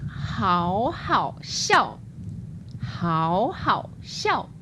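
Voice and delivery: 好好笑 said very slowly, each syllable's tone distinct; slow clear tones. Shown as a demonstration. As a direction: drill 好好笑 slowly for the class. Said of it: "I've got one more".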